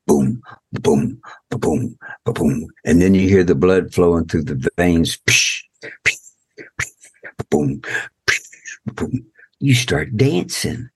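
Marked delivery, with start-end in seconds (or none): 0.1-2.7 low imitated heartbeat boom. 5.3-9.2 imitated blood flow and heartbeat.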